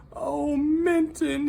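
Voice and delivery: high, loud, drawn-out call; crying out. A narrator's reading. Hector adrift at sea calling for his lost friend. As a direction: call desperately into the storm. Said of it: "he cried".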